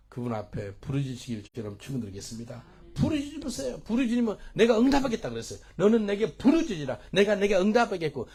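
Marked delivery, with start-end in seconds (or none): 2.9-8.3 high, loud revival preaching; full-force preaching. Real, not acted. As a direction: urge the congregation with a raised, ringing cry. (힘껏 외치는 부흥회 설교)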